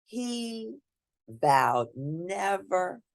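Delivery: ordinary delivery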